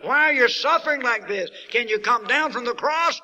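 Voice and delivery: loud, high pleading cry into the dark; crying out to Jesus on the cross; imagined shout. Pretend. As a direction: call out to him desperately. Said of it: "you shout back in that darkness".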